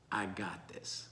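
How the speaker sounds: very soft, low near-whisper; hushed reassurance. Acted. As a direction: reassure them almost under the breath. Jesus reassuring the frightened disciples.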